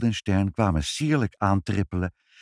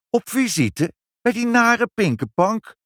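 much higher, louder incredulous cry; outraged disbelief; 13 semitones higher, 4 dB louder than usual. A narrator's reading. Daughter protests visiting the nasty Pinkepank. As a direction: recoil at the very idea.

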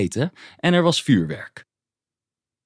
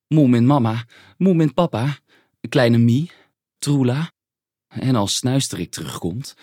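usual speaking voice

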